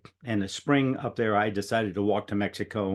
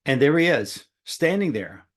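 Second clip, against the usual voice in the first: normal talking voice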